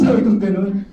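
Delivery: ordinary talk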